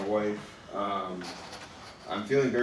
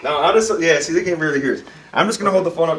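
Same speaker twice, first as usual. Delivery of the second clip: raised voice; 6 semitones higher, 11 dB louder than usual. Real, not acted. Raising voice for phone listeners.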